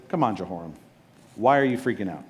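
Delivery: a hint of a calm prophet soothing; calming reassurance.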